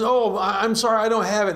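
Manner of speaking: high, nervous apology; apologetic debtor. Acted. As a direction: plead you have nothing.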